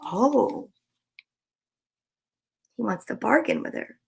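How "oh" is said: whispered surprised oh; gasp, then a whispered oh; hushed gasp. Real, not acted. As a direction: gasp it under your breath. Gasps at the frog's bargain.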